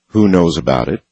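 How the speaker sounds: usual speaking voice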